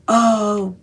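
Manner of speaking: loud gleeful yes; thrilled. Retold or imitated. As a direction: cry out in delight at the card. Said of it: "I'm like".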